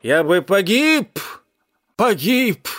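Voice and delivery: put-on high, slow, drunken rasped lament; melodramatic, strained cat cry; rasping cat. A narrator's reading. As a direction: wail it out slowly and hoarsely. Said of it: "прохрипел он".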